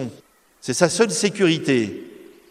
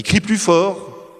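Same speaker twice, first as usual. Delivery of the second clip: louder cry; 7 semitones higher, 4 dB louder, much slower than usual. Shown as a demonstration. Priest urges the faithful to cry louder, raised and emphatic. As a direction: raise the voice on crie plus fort. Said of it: "je vous invite à crier plus fort".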